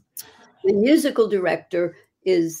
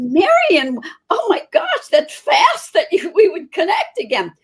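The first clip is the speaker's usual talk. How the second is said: much higher and louder, gosh leaping up; delighted surprise; 10 semitones higher, 5 dB louder than usual. Retold or imitated. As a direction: squeal with surprise at an old friend.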